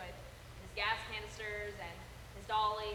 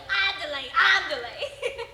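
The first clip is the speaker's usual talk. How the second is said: loud, higher mock shooing calls; Moe shooing; 7 semitones higher, 16 dB louder, slower than usual. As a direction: shoo them off loudly, stretching the last call. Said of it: "shooing them away like".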